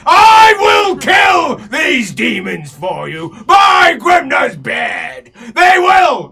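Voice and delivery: very loud, high, slow declaiming bellow; dwarf noble vowing to slay demons; dwarf battle oath. Acted. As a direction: proclaim it like a drunk hero. Dwarf swears oath to kill.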